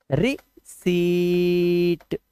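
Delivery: रिसीट very slowly, "ri... seeeet"; drawn-out silent-p reading. Shown as a demonstration. Teacher sounding it out for spelling, very slow रिसीट, no p. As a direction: split रिसीट and hold a long level "ee" before t. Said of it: "इसको रिसीट बोलते हैं".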